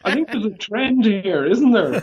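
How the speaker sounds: teasing himself through a laugh. Real, not acted. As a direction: chuckle the words out. Laughs at own bad memory.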